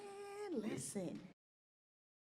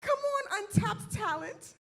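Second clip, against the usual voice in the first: high, loud laughing boast; real excited bragging; laughing hype; 10 semitones higher, 5 dB louder, slower than usual. Real, not acted. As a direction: crow about your own talent. Celebrating own freestyle.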